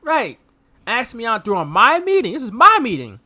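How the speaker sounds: mock indignant. [name] protesting to Rip, mock indignant. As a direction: sound outraged on her behalf.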